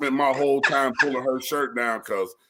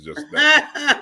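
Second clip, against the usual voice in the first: laughing; 5 dB louder than usual. Real, not acted. Joking about relatives.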